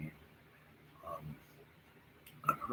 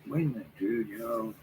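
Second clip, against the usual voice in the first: retold indignant protest; 3 semitones higher, 9 dB louder than usual. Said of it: "I'm like".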